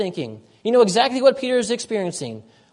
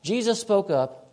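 ordinary delivery

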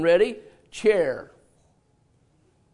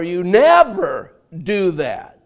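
louder, slow accusation word by word; slow reproach; 6 dB louder, much slower than usual. Retold or imitated. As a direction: drag out the blame. Acted reproach in sermon example.

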